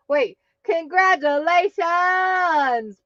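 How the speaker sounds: normal talking voice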